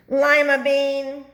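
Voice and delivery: high, loud, slow shout of the name; starting to snap. A narrator's reading. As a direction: bark his name in alarm. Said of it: "Snap Bean started to snap".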